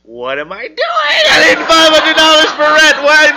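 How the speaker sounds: high, loud, wailing mock panic; comic freak-out rising to a loud, high wail; inner panic. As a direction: scream it inside your own head, in dismay. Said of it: "in my head, I'm going".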